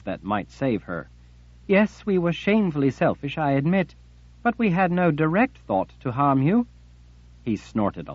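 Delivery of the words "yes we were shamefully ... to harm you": high, desperate confession to her master; beg, pitched high with fear; frightened pleading. Acted. Armina pleads with Jagang.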